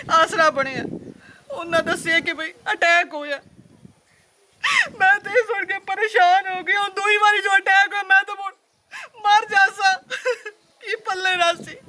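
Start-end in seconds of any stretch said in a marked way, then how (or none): 5.0-11.8 higher, tearful pleading with sobs breaking in; put-on crying. Sister to brother pleading for money, staged crying. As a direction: sob it out to win his pity. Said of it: "ਮੇਰੀ ਭੈਣ ਰੋ ਕੋਈ ਨਾ".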